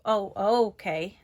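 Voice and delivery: ordinary talk, nothing marked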